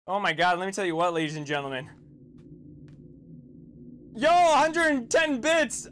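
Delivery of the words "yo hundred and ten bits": loud, high and slow thank-you; excited shout. Real, not acted. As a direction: hype it up, stretched out. Thanking for bits.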